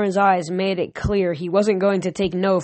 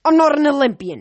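a bitter, raised growl of a slighted god; angry growl; 8 semitones higher, 5 dB louder than usual. A narrator's reading. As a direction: snarl it up high and loud. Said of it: "he growled".

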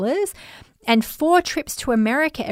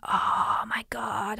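long drawn-out, horrified whisper; mortified whisper; 7 dB quieter, much slower than usual. Retold or imitated. Client realises she's thought pregnant, acted mortified whisper. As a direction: whisper it very slowly, reliving the embarrassment. Retold mortified reaction at salon.

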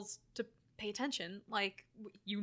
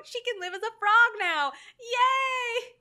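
put-on high, loud cheering as the little girls; mock gleeful cheer; 12 semitones higher, 8 dB louder, slower than usual. Podcaster voices little girls celebrating the frog, mock gleeful cheer. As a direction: squeal it like imitated delighted kids.

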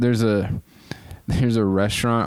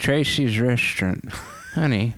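put-on toothless old person. Shown as a demonstration. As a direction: play a toothless old diner host. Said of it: "very old person with no teeth".

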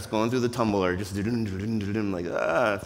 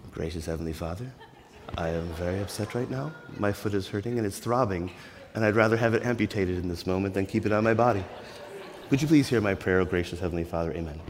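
soft, quieter, overly proper prayer voice; mock polished prayer; 4 semitones lower, 4 dB quieter than usual. New believer mocking polished prayers, soft and prim. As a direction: pray like a too-holy churchgoer, all polish. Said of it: "this sort of polish about them".

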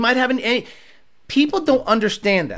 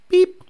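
high beep; 9 semitones higher than usual. Retold or imitated. Imitating torque wrench beep.

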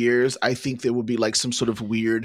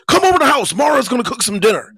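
much higher, louder, fast invitation; imitating the coach; 12 semitones higher, 6 dB louder, faster than usual. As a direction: voice the coach, high and eager. Imitating coach inviting him over.

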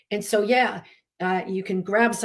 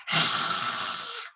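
lion's breath exhale; 4 dB quieter than usual. Shown as a demonstration. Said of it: "Like lion's breath".

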